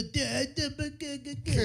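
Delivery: lightly high mock Italian stutter; a hint of an Italian accent, repeated. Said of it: "Do your Italian voice".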